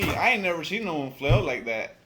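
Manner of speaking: ordinary delivery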